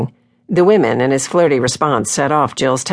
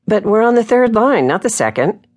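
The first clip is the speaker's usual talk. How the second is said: higher-pitched, mild objection; protesting; 9 semitones higher than usual. A narrator's reading. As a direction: object with a rise in pitch, then settle. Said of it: "Jill protested".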